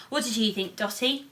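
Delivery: ordinary delivery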